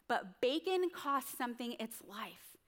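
normal talking voice

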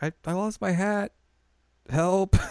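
put-on high little-girl plea; childish Chibiusa. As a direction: ask for help like a small child. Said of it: "and Chibiusa's like".

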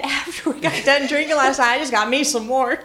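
half-laughing his words; quoting the husband through rising laughter; laughing while quoting him. Real, not acted. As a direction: let the laugh break into his line. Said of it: "it was hilarious".

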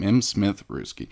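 normal talking voice

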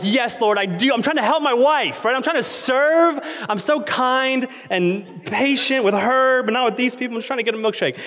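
high-pitched, indignant self-righteous plea; righteously angry. Retold or imitated. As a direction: protest to God in mock righteous anger. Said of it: "I'm, like, righteously angry".

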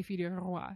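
FILLE DU ROI said the French way; rolled French R. Shown as a demonstration. French namer cueing the translator, rolled R in Roi. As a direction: roll the R in "Roi". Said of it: "I could be the French namer".

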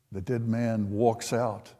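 ordinary delivery

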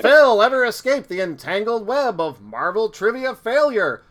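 dramatic announcer outro for the podcast episode; announcer teaser. Pretend. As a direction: tease the next episode like an old serial narrator. Cliffhanger outro in announcer style.

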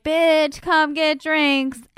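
put-on slow, drawn-out rich girl; bitchy rich girl. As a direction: call her out lazily. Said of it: "they're like".

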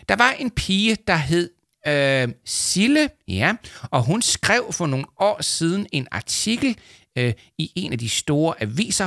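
high-pitched, halting recital; pompous character fishing for details; self-satisfied boaster recalling. Acted. Leo recites a fed example.

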